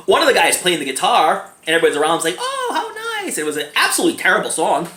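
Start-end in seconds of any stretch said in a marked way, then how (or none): 2.4-3.4 very high, gushing imitation; mock cooing. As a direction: coo it sky-high like a charmed onlooker. Mimics listeners cooing over guitar.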